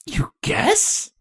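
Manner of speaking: high, shocked disbelief; as if that's unthinkable; incredulous. Acted.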